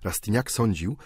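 ordinary delivery

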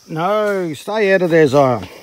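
loud, slow stern shout; shouting warning. Real, not acted. Shouting at child near mud.

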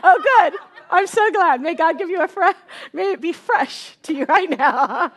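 high, loud talk through laughter; laughing. Real, not acted. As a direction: tease him while still laughing. Laughing banter with husband.

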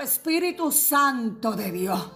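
normal talking voice

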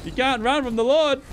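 high, loud gloating proclamation; mock preacher taunt. Repeats taunt, higher.

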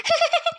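quick high giggle; a little teasing giggle; wife's short teasing giggle; short high laugh. Acted. Wife laughs at him.

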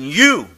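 "you" hit very loud and high; emphatic you. Retold or imitated. Jesus pointing at his listeners, loud stressed "you". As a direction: jab the word "you" at them. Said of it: "he's saying".

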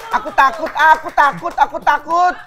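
high whiny pretend fright; mock fear. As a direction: chant it in hammed-up fear. Plays scared of scary places.

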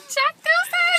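high, loud mock holler; teasing high-pitched yell; mimicking friend's holler. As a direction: imitate a friend's whooping catchphrase. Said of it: "You're like".

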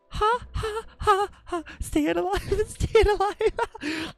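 high, laughing chant of stay alive; laughing sing-song. Pretend. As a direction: giggle through the words like a CPR beat. Laughing CPR rhythm.

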